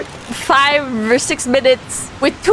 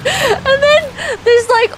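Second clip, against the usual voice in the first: laughing while talking; 12 semitones higher, slower than usual. Real, not acted. Cracking up retelling the mishap.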